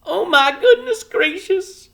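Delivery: very high, loud slow wail; a little mother overwhelmed, half crying; moaning. A narrator's reading. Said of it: "moaned Homily again".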